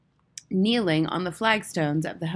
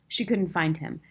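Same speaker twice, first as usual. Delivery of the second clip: plain reading voice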